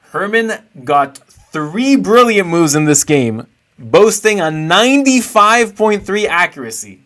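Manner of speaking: slow, loud build of amazement; excited. Real, not acted. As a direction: announce the big stat like a hype man. Amazed by a viewer's brilliant moves.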